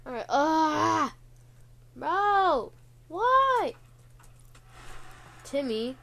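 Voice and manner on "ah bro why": anguished high yell, rising to a shriek; panicking gamer. Real, not acted. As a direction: cry out in despair at the screen. Run ruined by Timmy appearing.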